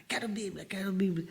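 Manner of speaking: unvoiced whisper of soldiers; whispered demand. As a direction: whisper it, wanting bibles. Soldiers asking for bibles.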